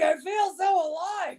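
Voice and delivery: shouting with adrenaline after a kick to the head; giddy thrill. Retold or imitated. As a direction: slowly cry out how alive you feel. Retold adrenaline rush after injury.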